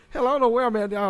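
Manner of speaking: ordinary delivery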